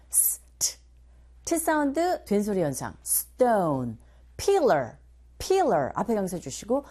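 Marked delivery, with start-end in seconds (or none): none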